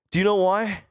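usual speaking voice

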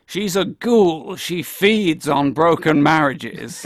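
slow, loud, dramatic husband's denunciation; acted-out domineering husband, drawn out; Roger's scornful pronouncement. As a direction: pronounce it slowly and loudly, hammed up. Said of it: "and he says something like".

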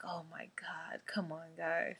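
whispered, exasperated groan. Retold or imitated. Host reacting to the fake doll in Nightmare on Elm Street, whispered exasperation. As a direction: whisper it at the screen in disbelief. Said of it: "And I'm just like".

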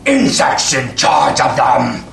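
gruff orc snarling threats. Acted. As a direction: growl it like a brutish orc. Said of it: "you can hear the orc respond to him".

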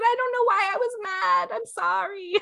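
high, remorseful sorry; a lightly tearful, high apology; teary apology. Retold or imitated. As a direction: plead forgiveness near tears. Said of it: "And you said".

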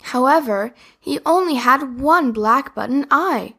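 plain reading voice

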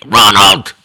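imitation of a mother calling out a misbehaving son; scolding mother. Mother calling her son who did something bad, mock scolding. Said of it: "sounds like my mother's calling me".

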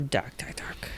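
whispered, ominous repetition; spooky whisper. Pretend. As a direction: hush it like a secret.

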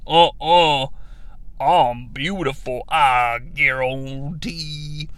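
low, slow, soft creature voice; low Croc voice. Retold or imitated. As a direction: drawl it like Killer Croc. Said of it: "he's like".

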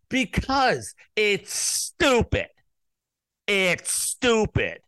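slow, drawn-out exasperation; exasperated insistence. Real, not acted. Host answers listeners who dislike the election denier talk, exasperated insistence. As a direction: stretch it out as if they'll never get it.

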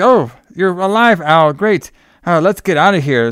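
mock excited relief. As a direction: gush over him like a cheesy ending. Tanya greets Al back alive.

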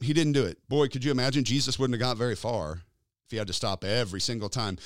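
normal talking voice

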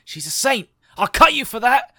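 loud, high outraged snake yell; furious snake. Acted. GM voicing the snake defending its mother against gnomes. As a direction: shout it in outrage.

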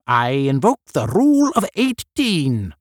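crying out. A narrator's reading. One of eighteen invoking a rule, crying out. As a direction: proclaim it like a solemn invocation. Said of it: "cried one".

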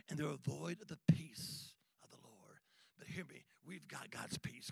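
hushed, soft and low; whisper. Acted. Preacher hushing the congregation, whispered. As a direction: drop to a whisper. Dramatic sermon hush.

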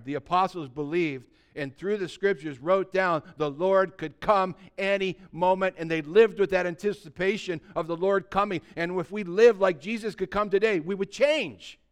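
put-on fired-up preacher calling out the older folks; preaching at full force. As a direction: pound it home to the congregation.

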